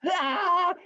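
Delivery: high mock diving yell; straining cry. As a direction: cry out while lunging for the line. Rabbit dives for the finish.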